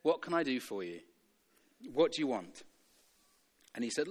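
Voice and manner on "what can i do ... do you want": soft, lower-pitched gentle question; calm reply. Acted. Jesus turning to the blind man, calm reply. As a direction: drop to a quiet, measured answer. Jesus answers the shouting beggar.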